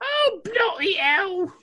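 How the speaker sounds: high, very slow shouted British exclamation; mock British. Repeating the joke for laughs, slow shouted British accent. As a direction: stretch it out like a fed-up Brit.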